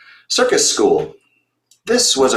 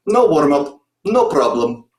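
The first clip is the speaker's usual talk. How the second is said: put-on light Russian accent; 3 semitones higher than usual. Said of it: "terrible Russian accent".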